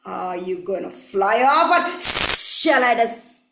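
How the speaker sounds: low, slow, hissing snake; unhurried threat in a deep snake voice; menacing snake. Acted. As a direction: stretch the s sounds into long hisses.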